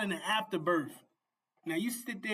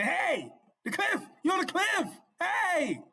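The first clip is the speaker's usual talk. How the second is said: higher and louder, calling out hey at the cliff; yelling a warning; 10 semitones higher, 4 dB louder, slower than usual. Retold or imitated. Preacher yelling to a man walking off a cliff, loud warning. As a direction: shout to stop someone stepping off. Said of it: "Yelling out".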